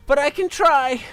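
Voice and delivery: high, loud little creature; high goblin voice. Acted. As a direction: call it out in a small creature's voice. Zeg voiced while fighting.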